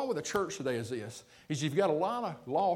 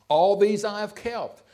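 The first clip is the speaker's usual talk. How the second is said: proud boast; 4 semitones higher, 8 dB louder, slower than usual. Acted. Young man boasting to Jesus.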